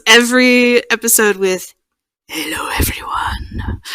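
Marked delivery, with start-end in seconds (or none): 2.3-3.8 deep husky whisper imitating the ASMR host; French Whisperer's husky whisper. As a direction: breathe it out like a sleepy ASMR greeting. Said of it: "deep, husky voice".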